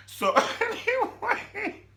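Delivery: ordinary talk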